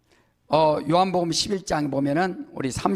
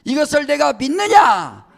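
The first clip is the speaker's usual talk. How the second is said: loud, higher shouted challenge; shouted question; 7 semitones higher, 9 dB louder than usual. Shown as a demonstration. Preacher challenging the congregation to answer amen, shouting. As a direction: fire the question at them. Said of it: "아멘 하시기 바랍니다".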